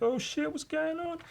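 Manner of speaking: very high, thin goblin squeak; squeaky scared goblin. Acted. Goblin lifted by the spacesuit guy, high scared squeak. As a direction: squeak it like a frightened little goblin. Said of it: "He's looking like".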